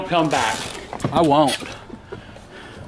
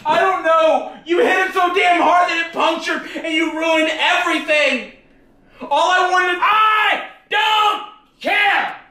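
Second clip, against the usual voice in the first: shouting in anger; 8 semitones higher than usual. Real, not acted. Son screaming at grandpa over the broken iPad, furious shout. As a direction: yell back furiously at grandpa.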